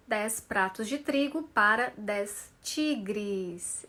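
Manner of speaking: slow, every syllable punched; slow and loud. Shown as a demonstration. Teacher drilling the tiger twister for students. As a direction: spell the twister out slowly. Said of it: "e falando alto aí".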